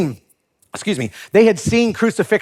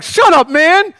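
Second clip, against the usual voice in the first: high, loud mocking chant; teasing ribbing; 10 semitones higher, 5 dB louder than usual. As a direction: rub it in like a schoolyard taunt. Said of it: "He was like".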